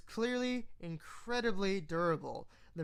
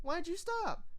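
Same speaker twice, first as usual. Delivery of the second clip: much higher squeaky pony plea; high pouty Pinkie voice; 12 semitones higher than usual. Acted. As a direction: whine the question. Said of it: "in perfect zebrakin".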